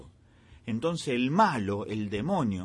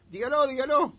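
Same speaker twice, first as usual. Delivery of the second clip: high, loud nudge to speak up; urging; 11 semitones higher, 6 dB louder than usual. Real, not acted. Host pushes a nervous guest to answer, urging. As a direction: coax a nervous guest, higher and louder.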